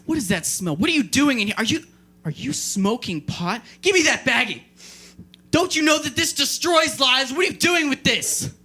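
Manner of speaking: a hint of a stern father scolding; mock angry dad. Dad catching his kid smoking pot, mock angry scolding. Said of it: "I bust and I'm like".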